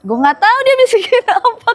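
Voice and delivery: giggling high through the words; laughing. Real, not acted. Guest laughs at his own blank memory, voice high. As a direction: laughs as he admits he doesn't know. Laughing while retelling the whisper.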